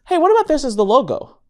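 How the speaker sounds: high, lightly excited pitch of an idea; imitating wife's suggestion. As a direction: float it brightly, up high. Acting out wife's eager suggestion.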